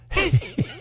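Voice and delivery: high burst of laughter. Real, not acted. Laughter at own joke.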